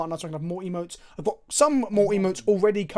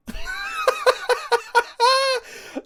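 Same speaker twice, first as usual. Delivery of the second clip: shrieking laugh in a long run; high cackle. Real, not acted. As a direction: cackle helplessly at the top of your voice. Delighted by his absurd combo.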